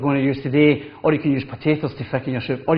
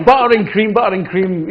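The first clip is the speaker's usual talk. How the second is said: higher, louder playful exclamation; butter and cream; 4 semitones higher, 4 dB louder than usual. Real, not acted. Chef jokes to Olive about his love of cream, playfully louder. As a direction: ham up the chef's love of butter.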